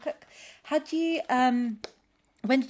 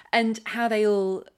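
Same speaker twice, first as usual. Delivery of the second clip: ordinary talk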